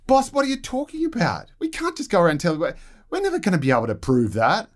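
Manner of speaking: shocked advisor. Retold or imitated. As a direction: squeak out the aide's disbelief. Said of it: "the advisors go".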